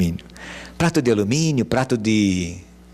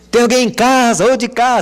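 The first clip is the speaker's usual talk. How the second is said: high, loud call to the house; 13 semitones higher, 8 dB louder than usual. Shown as a demonstration. Visitor calling into the house, loud. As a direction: call across the yard. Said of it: "Como é que a gente diz?".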